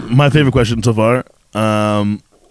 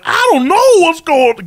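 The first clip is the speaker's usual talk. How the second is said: high, loud, bewildered put-on voice; high befuddled yelp; clueless noir character; 6 dB louder than usual. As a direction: play the lost fool in a noir. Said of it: "and I'd be like".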